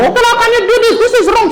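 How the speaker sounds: very high, scolding mother voice. Acted. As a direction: scold the drunk husband like an angry mum.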